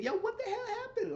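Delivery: jumps way up high, laughing through it; high mock voice. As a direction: play Lynette as a squeaky, giggly caricature. Voicing Lynette in retelling.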